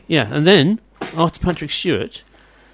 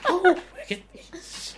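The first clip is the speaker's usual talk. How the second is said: high, slow words through laughter; laughing; 5 dB quieter, slower than usual. Real, not acted. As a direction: giggle it out. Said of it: "laughing at the clip".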